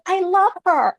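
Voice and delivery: very high squealing fangirl voice; imitated young girls. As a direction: squeal it like an adoring young fan. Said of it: "little girls came up to me".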